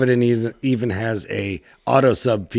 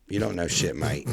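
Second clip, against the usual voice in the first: put-on Australian accent; 7 semitones lower, 11 dB quieter than usual. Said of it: "I love that you threw in mate".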